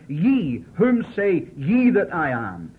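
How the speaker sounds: "Ye" hit hard and loud; emphatic, personal question. Retold or imitated. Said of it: "emphatic way, he directs the question".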